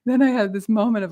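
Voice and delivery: normal talking voice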